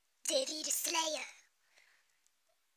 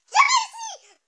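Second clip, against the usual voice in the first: high, loud victory cry with a laugh; triumphant shout; 16 dB louder, slower than usual. Real, not acted. Reader finally getting the name, laughing triumphant shout. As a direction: cheer like you just won.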